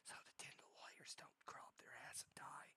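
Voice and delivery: very soft, secretive whisper; ASMR whisper. Shown as a demonstration. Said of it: "It's not a time for A S M R".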